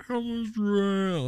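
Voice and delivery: slow, dragged quote; mock slow-motion question. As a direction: stretch it out like a slow-motion replay. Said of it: "And all slow-mo".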